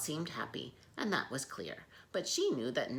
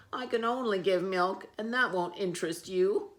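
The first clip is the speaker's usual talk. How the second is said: gloomy cow voice; 5 semitones higher, 7 dB louder than usual. Acted. Sad cow replies.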